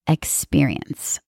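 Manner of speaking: "experience" slow, low and soft after a pause; emphasis on experience. Real, not acted. Therapist repeating the definition's key word, weighty slow "experience". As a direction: set "experience" apart, slow falling weight. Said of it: "I emphasize that word".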